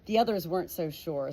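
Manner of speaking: usual speaking voice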